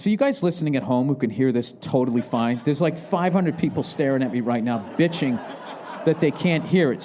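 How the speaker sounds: ordinary delivery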